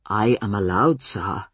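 low, humble and polite; deferential. A narrator's reading. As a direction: address the guard respectfully. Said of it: "still in that deferential tone".

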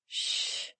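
very quiet, drawn "shh"; whispered shushing sound; soft shush. A narrator's reading. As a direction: hush them gently, all breath. Hushing him in secret.

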